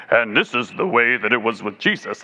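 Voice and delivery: low, rich imitation of Abe's voice; imitating a deep voice. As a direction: drop the voice low like the singer. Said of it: "you had a voice like that".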